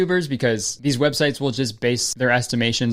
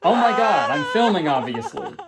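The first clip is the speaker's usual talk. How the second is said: snapping at his wife; 6 semitones higher than usual. Pretend. Fake vlogger snapping at crying wife.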